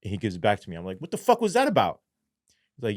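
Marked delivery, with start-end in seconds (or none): 1.0-2.0 high-pitched, baffled demand; incredulous and much higher; retold indignant question. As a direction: demand an explanation in disbelief. Demanding explanation after search.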